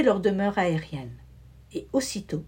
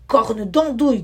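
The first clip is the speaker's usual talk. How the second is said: louder grumpy oath; growling wizard; 8 dB louder than usual. A narrator's reading. Wizard out of drawing space, growling his oath louder. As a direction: growl the curse like a cross wizard. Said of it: "gronda-t-il".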